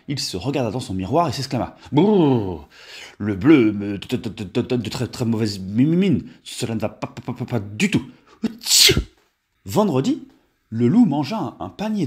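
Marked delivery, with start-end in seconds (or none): 1.9-8.0 stammering with cold after a long brrr; teeth chattering. A narrator's reading. Wolf out of an icy bath to his mirror, teeth chattering. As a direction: shiver through it, stuttering on the first sounds. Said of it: "En claquant des dents".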